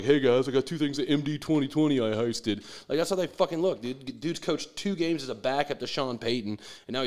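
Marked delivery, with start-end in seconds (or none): 0.0-2.6 a lightly voiced sneaky teen bragging; mock teenager. Mocking the young coach as a prom kid, light teen voice. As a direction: brag like a kid with stolen booze. Imitating teens sneaking booze.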